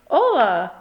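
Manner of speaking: loud hollered hello; hollering. Acted. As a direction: holler back across the yard. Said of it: "hollered back Scapito Fresquito".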